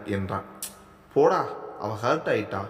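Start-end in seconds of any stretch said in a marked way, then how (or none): none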